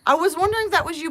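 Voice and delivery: fast, loud and high; excited rush on spotting a returning viewer. Real, not acted. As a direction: blurt it out in delighted recognition.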